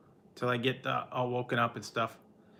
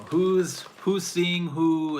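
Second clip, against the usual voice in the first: high, louder, slow gossipy question; mock gossip; 5 semitones higher, 4 dB louder, much slower than usual. Streamer mocking office gossip, high slow nosy voice. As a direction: savor the gossip like a nosy coworker.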